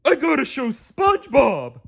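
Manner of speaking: high dopey starfish voice, eager; a goofy pal rushing to share news; Patrick voice. A narrator's reading.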